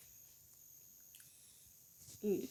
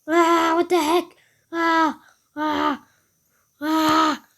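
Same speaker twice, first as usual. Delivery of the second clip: higher, louder alarmed cries; panicked cries; 5 semitones higher, 6 dB louder, slower than usual. Acted. Character spotting infected cats, panicked cries. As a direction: yelp in mock panic.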